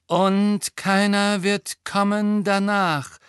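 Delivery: slow, high, sustained intoning; ghostly seeress. A narrator's reading. Young woman's figure rising from shards, prophesying.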